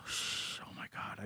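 whispered shocked realization; hushed gasp. Retold or imitated. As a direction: breathe it out in awe.

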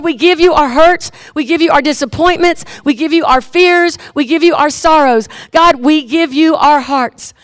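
loud, earnest pastoral prayer building in intensity; fervent prayer. Acted. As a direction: pray aloud over the congregation. Pastor leads closing prayer.

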